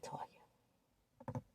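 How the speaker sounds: whispered aside. Real, not acted. Aside rebutting Toya.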